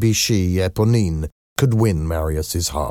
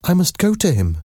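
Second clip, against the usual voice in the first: high-pitched, urgent daughter; Cosette's voice; 11 semitones higher than usual. A narrator's reading. Daughter insisting to papa on leaving, high-pitched. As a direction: insist in a much higher pitch.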